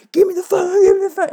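high-pitched mock squeal for the phone; frantic pleading. Granddaughter begging for the phone from the background, high and frantic.

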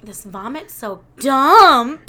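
lightly imitated TV character, last word drawn out loud; slow quote with a big punch on "dumb"; a hint of sitcom disdain, stretching "dumb"; David Rose. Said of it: "He's like".

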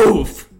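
Mario oof. Shown as a demonstration. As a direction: demonstrate a big cartoon oof. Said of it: "He's more like".

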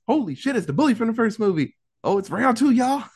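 high-pitched hyped-up exclamation; excited recognition. Retold or imitated. Retelling the bully's return from the first movie, excited. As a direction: crow it like announcing a rematch.

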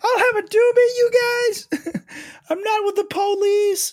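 very high goofy voice; silly high voice. Pretend. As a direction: squeak it out like a cartoon. Mock undercover cop offer.

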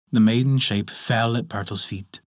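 usual speaking voice

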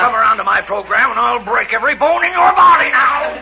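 loud angry threat. Acted. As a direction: bellow a threat to break bones. Sponsor threatening angrily.

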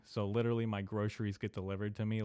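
ordinary talk

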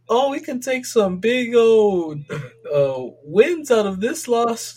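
mock the team's spin, drawn out; mocking quote. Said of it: "And they're like".

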